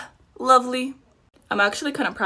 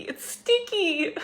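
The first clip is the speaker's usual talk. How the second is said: high, slow, drawn-out squeal; excited squeal; 9 semitones higher, much slower than usual. Real, not acted. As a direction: stretch it out, high and gleeful. Retake of sticky complaint.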